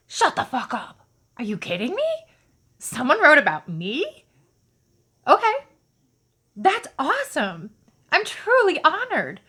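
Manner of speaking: a retold shocked thrill; stunned excitement. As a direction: gush in disbelief, then honored. Stunned reaction to news of letter.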